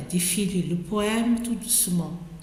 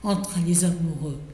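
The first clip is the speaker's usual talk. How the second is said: measured poem reading with pauses between phrases; poetry recitation; 3 semitones lower than usual. Acted. Reader recites Ronsard's ode to Cassandre, measured poetic recitation. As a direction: recite it line by line, giving each phrase room.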